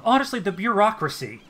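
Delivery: fed up with officials; exasperated noble. Acted. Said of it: "Erelaya says".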